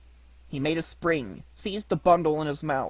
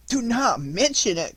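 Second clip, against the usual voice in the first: groaning; 9 semitones higher, 3 dB louder than usual. Acted. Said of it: "groaned Pinocchio".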